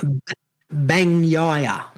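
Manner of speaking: Bagnaia said slowly, syllable by syllable; slowed down. Shown as a demonstration. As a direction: drag out B- Bagnaia. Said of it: "I'll slow it down for you".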